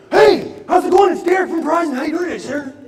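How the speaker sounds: high, loud, beaming sales pitch; overly enthusiastic salesman. Retold or imitated. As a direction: pump every word full of eager cheer. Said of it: "He's so enthusiastic".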